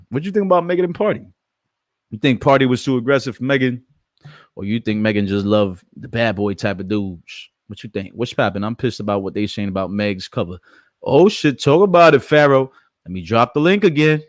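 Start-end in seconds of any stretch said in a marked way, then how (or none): 0.1-1.3 goofy voice. Pretend. Repeating the question to chat in high fast goofy voice. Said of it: "my goofy voice". 11.0-14.2 high, loud goofy voice. Pretend. Hyping Pharaoh in chat with a high loud goofy voice. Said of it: "my goofy voice".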